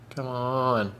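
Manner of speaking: ordinary talk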